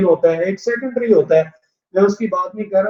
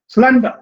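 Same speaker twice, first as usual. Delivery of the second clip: "Slender" with स्लें punched, डर light; stress on first syllable; 4 semitones higher, 4 dB louder, faster than usual. Shown as a demonstration. Teacher giving an adjective example, first syllable stressed. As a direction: hit the start of "slender". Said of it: "पहला syllable जो stress होगा".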